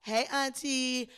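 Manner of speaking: normal talking voice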